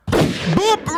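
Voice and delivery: high imitated horn boop; vocal horn. Hype man hails the production, vocal horn. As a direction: punch out a horn noise.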